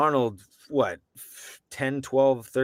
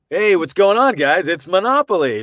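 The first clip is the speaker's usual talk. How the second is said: higher, louder hyped announcer greeting; loud, high, fake-excited ad voice; put-on hype voice; 6 semitones higher, 7 dB louder than usual. Shown as a demonstration. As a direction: sell it like an over-eager ad host. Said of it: "I don't have to put on".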